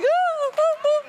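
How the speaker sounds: very high wailing "ooh" sobs; hammed-up bawling in squeaky bursts; mock ugly cry. Storyteller showing how she bawled in the car, mock ugly cry. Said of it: "I'm doing the ugly cry now".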